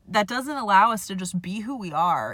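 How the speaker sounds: usual speaking voice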